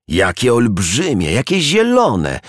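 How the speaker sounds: slow, high-pitched marvelling; awestruck, swooping upward; admiring wonder. A narrator's reading. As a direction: marvel at it, drawing the words out and rising high. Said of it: "podziwiała drzewa".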